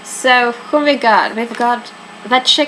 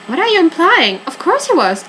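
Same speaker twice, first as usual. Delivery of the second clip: indignant retort; 9 semitones higher, 3 dB louder than usual. Acted. Suspect offended by the question.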